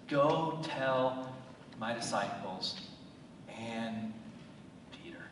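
angel's message to the women, fading soft; fading to quiet. Acted. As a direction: let it fade to almost nothing on Peter. Angel's words, dropping away.

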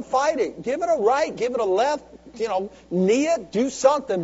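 loud, high, driving sermon build; rally them to keep fighting; fiery preaching. Acted. Preaching at full force.

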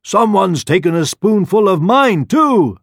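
high, loud Mama Bear voice; mother bear exclaiming. A narrator's reading. Mother Bear finds her porridge tasted too, exclaiming. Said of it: "exclaimed the mother bear".

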